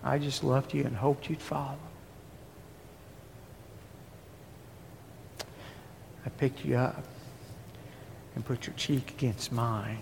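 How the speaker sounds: hushed voice of God; quiet God voice. Acted. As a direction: say it softly as God letting go. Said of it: "he said".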